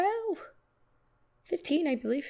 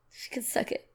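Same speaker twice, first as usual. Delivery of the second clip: muttered aside; 8 dB quieter than usual. Real, not acted. Dismissive aside at the end.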